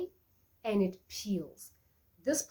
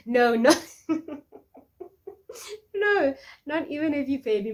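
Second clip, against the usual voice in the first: higher and louder, laughing it off; cheeky loud no, much higher; loud playful refusal; 7 semitones higher, 10 dB louder than usual. Real, not acted. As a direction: shout the refusal through a laugh.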